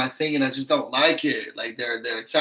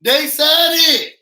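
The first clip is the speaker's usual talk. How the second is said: loud, high, very slow shout; emphatic shout; 10 semitones higher, 7 dB louder, much slower than usual. Real, not acted. Vlogger insisting to viewers that the housemates really said it, shouting. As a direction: nail it down by shouting each word.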